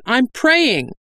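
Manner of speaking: high-pitched defensive announcement; ringing out. A narrator's reading. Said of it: "Dahl's voice rang out".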